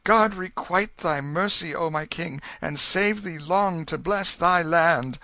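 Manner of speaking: put-on boy thanking the king in joy; glad cry. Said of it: "with a glad cry".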